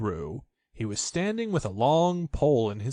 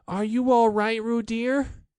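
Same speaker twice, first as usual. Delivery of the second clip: high, loud anxious mother's call; Kanga anxious; 11 semitones higher, 4 dB louder, slower than usual. A narrator's reading. Kanga calling to Roo in the water, anxious and high. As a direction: call out worried for your child. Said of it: "called Kanga anxiously".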